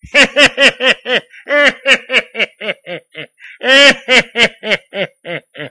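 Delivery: high, loud staccato cackle in waves; long devilish laugh. A narrator's reading. Devil laughing at Lily's failed throw, long cackle. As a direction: laugh on and on wickedly. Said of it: "Le diable se mit à rire".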